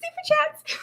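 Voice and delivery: squeaky high-pitched thanks, half shouted; goofy high voice. Pretend. Thanking viewers for super chats in a squeaky voice. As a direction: play the dork with a silly high voice. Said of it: "I'm such a dork".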